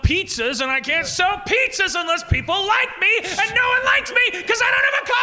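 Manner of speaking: escalating high shout, hammed-up desperation; acted angry rant. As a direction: build from a loud complaint to a very high, wailing yell. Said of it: "And he's like".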